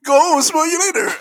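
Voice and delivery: loud, high shouted catchphrase; shouted quote. Acted. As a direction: belt out the line. Quoting Fresh Prince line.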